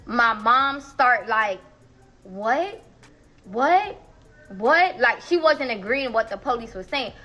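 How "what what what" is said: imitating mom's disbelief. Mom disputing what police said.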